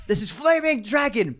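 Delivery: high, slow menacing caller voice; mock terrorist voice. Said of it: "he goes".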